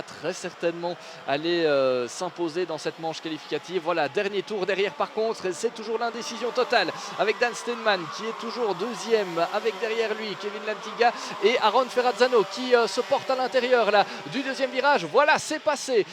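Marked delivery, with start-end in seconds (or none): 3.8-15.1 higher and louder, rising excitement; excited commentator. Acted. Last-lap battle called live. 15.1-16.0 "Voilà, c'est passé" shouted high and loud; excited shout. Acted. Overtake called at peak.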